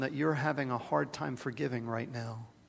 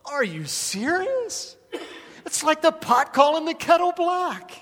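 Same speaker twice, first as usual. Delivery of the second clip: Jesus, incredulous; 7 dB louder than usual. Retold or imitated. Preacher voicing Jesus to the crowd, incredulous and high. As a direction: play Jesus amazed at the hypocrisy. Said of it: "Jesus must have thought to himself".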